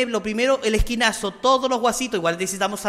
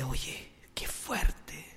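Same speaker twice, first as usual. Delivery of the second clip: whisper; 5 semitones lower, 10 dB quieter, much slower than usual. Retold or imitated. Friend whispers after the exorcism.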